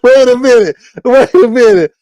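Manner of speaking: loud, high-pitched disbelief, each wait hit hard; stunned double take. Retold or imitated. Reacting to a viewer's AI photo, loudly stunned. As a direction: stop short at a surprise and repeat it. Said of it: "I was like".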